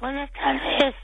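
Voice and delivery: real hoarseness, soft and weak; hoarse little voice. Real, not acted. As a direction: greet with barely any voice left. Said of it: "qué vocecita".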